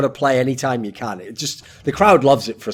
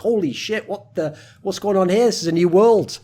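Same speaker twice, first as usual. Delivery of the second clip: amazed; 7 semitones higher than usual. Retold or imitated. Quoting amazed viewers.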